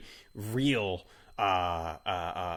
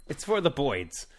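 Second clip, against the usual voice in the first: put-on higher-pitched echo in Brooklyn accent; mock Brooklyn accent; 4 semitones higher than usual. Echoes joke pronunciation of birds.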